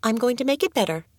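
put-on quick, high, determined girl's voice; exclaiming. A narrator's reading. Girl promising to heal the crow, exclaiming. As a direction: promise it brightly and fast. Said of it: "Adoet exclaimed".

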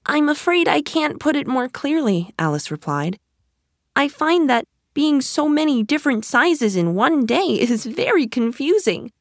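put-on high, earnest young girl; little girl voice. A narrator's reading. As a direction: explain it as a puzzled girl. Alice's voice held across lines.

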